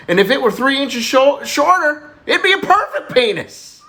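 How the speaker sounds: high, rising exclamation; proud boastful kid. Acted. Little Johnny showing little Susie, high boastful punchline. Boy proudly repeats brother's demonstration.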